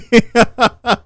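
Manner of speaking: high-pitched real laughter. Real, not acted. Streamer cracking up at an absurd quote, high laughing. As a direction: burst out in helpless high laughter.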